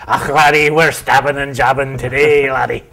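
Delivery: loud, high Scottish brogue; booming Scottish dentist voice; Scottish accent. Pretend. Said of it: "the Scottish jabber".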